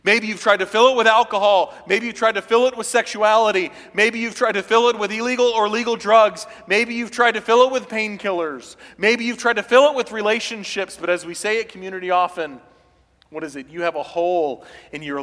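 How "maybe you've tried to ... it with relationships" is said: loud, rising sermon delivery; full-force preaching. Acted. Preacher to congregation, loud full-force preaching. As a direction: preach it out to the whole church.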